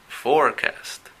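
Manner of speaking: Forecast said slow and deliberate; slow model of Forecast for learners; pronunciation model. Shown as a demonstration. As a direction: spell out Forecast slowly, syllable by syllable. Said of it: "voy a hacer algunos comentarios".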